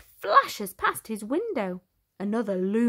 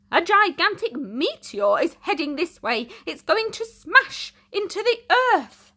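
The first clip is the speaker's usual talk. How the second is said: higher, louder, building alarm; urgent scientists' warning; 7 semitones higher, 4 dB louder than usual. Acted. Scientists warning Elliot, rising alarm. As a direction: warn of doom. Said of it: "urgent message".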